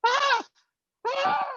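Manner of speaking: very high loud screams; imitating partner's panicked shrieks; mock screaming. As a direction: scream like a scared rider. Partner screaming on log flume.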